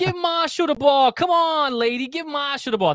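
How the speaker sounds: put-on Boston fan yelling for the kid to get the ball, thick Boston accent. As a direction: shout it like a Boston fan egging on the lady. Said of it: "yelling in this awesome Boston accent".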